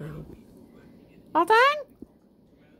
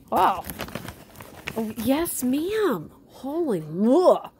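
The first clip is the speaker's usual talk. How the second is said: higher, stretched-out awe; amazed; 4 semitones higher, 4 dB louder, much slower than usual. Real, not acted. Owner marvelling at the pet, slow and drawn out. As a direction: marvel slowly at her.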